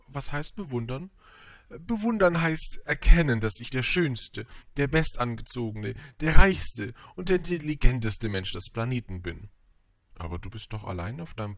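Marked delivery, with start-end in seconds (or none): 1.7-9.5 loud, grandiose self-praise; boastful vain man. Acted. Vain man defines admiration to the prince, loud boastful delivery. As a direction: boast each title with mounting pride.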